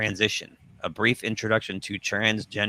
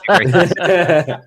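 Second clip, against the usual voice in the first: laughing; 8 semitones higher, 11 dB louder than usual. Real, not acted. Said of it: "That's exactly how it's said".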